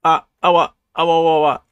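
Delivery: loud, high panicked babble; flustered yelping in rising alarm; panicked cry. Acted. Voicing panicked character in comic.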